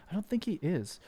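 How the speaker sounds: normal talking voice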